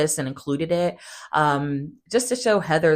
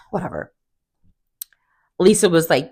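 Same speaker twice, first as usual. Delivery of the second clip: ordinary talk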